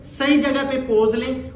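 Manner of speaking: normal talking voice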